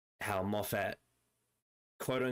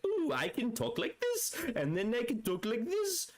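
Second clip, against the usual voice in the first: mock voice play. Explaining the Toymaker's accent games, high playful put-on voice. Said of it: "it's like".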